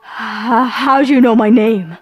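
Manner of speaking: startled blurt. A narrator's reading. Man hears the phantom say his name, blurting in alarm. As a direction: catch on the breath, then blurt it out. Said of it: "he blurted out without thinking".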